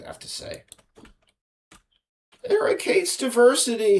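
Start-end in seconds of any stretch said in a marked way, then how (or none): 2.5-4.0 high, mock-outraged accuser voice; put-on high-pitched accusation. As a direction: mimic a critic tattling in a high voice. Mimics critics accusing him.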